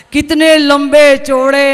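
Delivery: slow, stretched mock eulogy; drawn-out praise. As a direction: draw out each word in fake awe.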